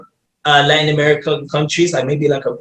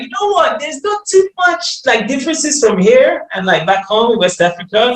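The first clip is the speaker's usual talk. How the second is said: excited retelling; 7 semitones higher than usual. Retold or imitated. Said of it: "I was like".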